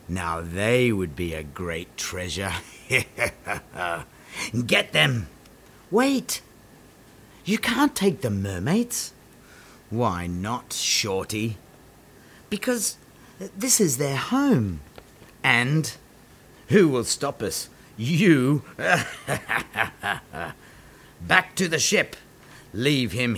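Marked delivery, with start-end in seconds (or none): 2.9-4.0 villain chuckle. Acted. Captain plans to catch mermaids. 18.7-20.5 a scornful pirate laugh; mocking laugh. Pirate mocks small hero.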